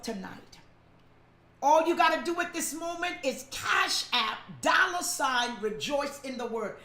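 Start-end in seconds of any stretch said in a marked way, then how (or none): none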